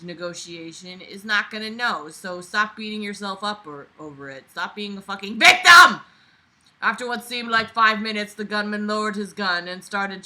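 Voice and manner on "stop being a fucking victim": loud frustrated shout; exasperated yell. Acted. Host yelling at the self-pitying narrator, exasperated. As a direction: bark it at him to get over it.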